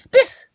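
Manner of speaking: sharp high loud sound effect word; loud biff. Acted. Ball knocks off the hat, loud biff. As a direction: smack it out like an impact.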